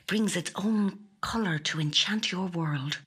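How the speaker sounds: breathy, hushed reading voice; dreamy whisper. A narrator's reading. Louise dreamily reading an auction listing aloud, whispered. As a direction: whisper it as if already enchanted.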